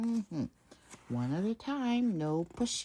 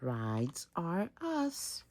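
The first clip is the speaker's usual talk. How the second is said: drawn out slogan; 6 dB quieter, much slower than usual. Acted.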